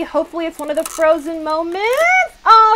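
ordinary talk

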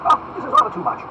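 high, loud lordly protest; ringing exasperation; indignant exclamation. Acted. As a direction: burst out as an affronted Duke. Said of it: "exclaimed Buckingham".

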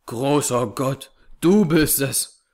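slow, gasped-out words of fright; gasping in shock. A narrator's reading. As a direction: gasp it out, startled. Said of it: "keuchte John Ferrier".

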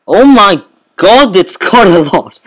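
loud, high burst of amazement; excited shout. Real, not acted. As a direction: shout it out in delight. Amazed at the machine's charge.

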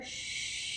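long hushing shh exhale; ocean breath exhale. Shown as a demonstration. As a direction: breathe out like a crashing wave. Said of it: "bringing your arms out".

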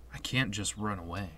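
low, soft downbeat retort; sullen game hero. Acted. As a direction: refuse quietly, voice sunk low. Voices Squall's sullen answer.

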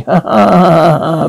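triumphant ha-ha cackle; gloating laugh. Acted. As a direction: cackle over your freed victim. Dummy gloats at being free.

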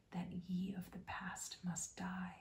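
plain reading voice

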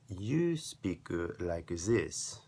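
English in a put-on foreign accent, pitch jumping on "you". Shown as a demonstration. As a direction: demonstrate a foreign accent, slowing at the end. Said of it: "pay attention to the sound of my voice".

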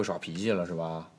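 coaxing slowly. Acted. Husband coaxes her to talk.

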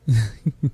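short, light chuckle; quick little laugh; soft chuckle. Acted. Teasing a shy guest.

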